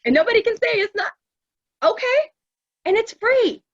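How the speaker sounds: loud, high, hammed-up sobby voice; exaggerated high weepy wail; mock boo-hoo crying. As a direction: blubber it out, imitating a tearful breakdown. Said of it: "like, boo-hoo crying".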